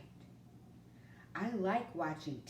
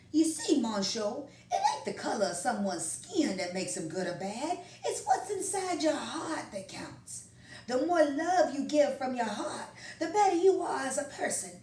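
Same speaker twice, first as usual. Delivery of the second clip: louder, folksy grandpa teaching his grandson; kindly grandpa; 9 semitones higher, 8 dB louder than usual. Acted. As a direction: explain it like a loving old grandfather. Grandpa voiced in story.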